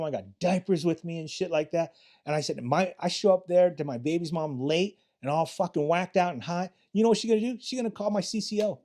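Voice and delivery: high-pitched street protest; retold indignant excuse. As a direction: make your excuse hotly, climbing to a punchy finish. Replays his undercover excuse to dealers.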